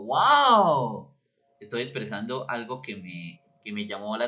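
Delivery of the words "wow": high, loud astonished wow; amazed wow. Shown as a demonstration. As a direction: sound truly amazed. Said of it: "si yo digo". (wow asombrado, agudo y fuerte)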